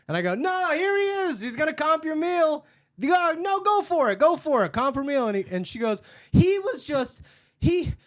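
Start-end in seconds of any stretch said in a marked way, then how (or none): 0.3-5.3 high, loud goading; mock encouragement. Worker goading the manager to comp her meal, loud mockery. 6.3-7.9 high, loud broken-off protest; flustered customer. Retold or imitated. Customer trying to tell the manager her story, mock flustered. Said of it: "she's trying to tell the story".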